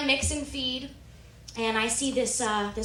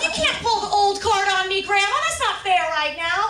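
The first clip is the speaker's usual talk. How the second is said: loud, high objection; acted exasperated complaint; indignant protest; 10 semitones higher, 7 dB louder than usual. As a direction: argue back in a raised voice. Granddaughter protesting guilt trip.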